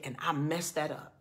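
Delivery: low, hushed regret, retold; almost in tears. Man confesses losing his wife, retold as almost in tears. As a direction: imitate a man owning his mistake, subdued. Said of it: "he's almost in tears".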